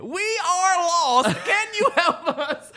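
mock lost traveller. Mocking lost travellers meeting him.

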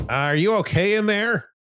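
high-pitched guard calling in; guard calling through door. Acted.

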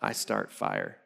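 put-on very low, soft deadpan toddler quote; toddler confession decoded. Dad translating his two-year-old's words, mock-ominous and low. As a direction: drop far down for a mock-ominous little confession. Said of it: "And he's two".

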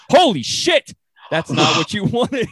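high, loud mock alarm breaking into a laugh; shocked doctor. Doctor peering with an otoscope, mock shocked, high and loud. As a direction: blurt it like a horrified doctor. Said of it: "looks in there and goes".

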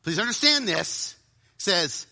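usual speaking voice

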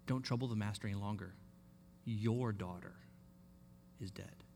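low, soft, flat delivery of "is dead"; soft, low messenger breaking the news; blunt bad news. Shown as a demonstration. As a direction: drop it quietly and heavily on "Your daughter is dead". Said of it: "maybe the emphasis went something like this".

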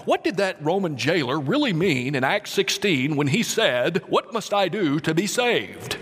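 hammed-up preacher intoning the question. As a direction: put on your best preacher voice, with wavering pitch. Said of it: "It's my best preacher voice".

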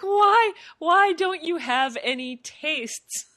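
loud, high, slow despairing cry; exasperated shout. Retold or imitated. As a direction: wail it in exasperation. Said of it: "I'm like".

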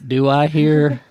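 lightly played auctioneer calling bids; a hint of a country auctioneer. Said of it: "I wanna hear Salt Lake as an auctioneer".

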